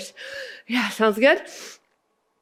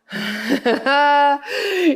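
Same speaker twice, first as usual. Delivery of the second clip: breathless laugh; 6 semitones higher than usual. Real, not acted. Laughing between sets.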